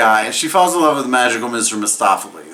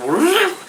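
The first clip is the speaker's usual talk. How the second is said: squeaky high magic whoop. Retold or imitated. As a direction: pop it out high. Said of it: "magical Mister Mistopheles is like".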